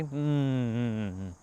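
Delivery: slow, soft timid hums; nervous humming. Retold or imitated. Streamer mimicking his old fear, slow nervous humming. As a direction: hum like too scared to speak. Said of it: "I used to have this fear".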